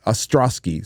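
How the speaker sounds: ordinary talk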